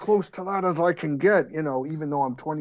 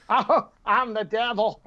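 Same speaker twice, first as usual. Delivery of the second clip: high campy devil line, much higher; Paul Lynde devil; 8 semitones higher than usual. Retold or imitated. Showing how he played the devil like Paul Lynde, high and campy. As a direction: camp it up like a sitcom devil. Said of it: "can I play him like Paul Lynde".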